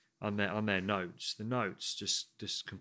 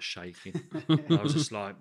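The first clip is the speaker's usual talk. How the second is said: usual speaking voice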